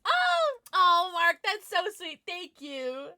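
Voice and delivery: high, loud gush of thanks; gushing, delighted "aww" voice; touched delight. Real, not acted. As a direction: squeal at a sweet compliment. Touched by a viewer's compliment.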